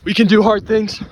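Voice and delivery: high, loud mantra shouted out; hyped shout. Real, not acted. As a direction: bellow it to pump yourself up. Hype mantra after midnight.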